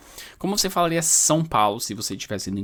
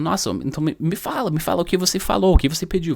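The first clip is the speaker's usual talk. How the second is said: rising, eager questions; wife pressing him to tell, eager and rushed; 6 semitones higher, 4 dB louder, faster than usual. Retold or imitated. Wife urging her husband to repeat his order, eager. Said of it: "Ela falou".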